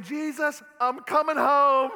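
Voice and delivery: hammed-up terrified cry, loud and laughing; loud laughing mock plea to Jesus mid-earthquake; mock panic. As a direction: act out panicking in the quake. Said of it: "I was saying".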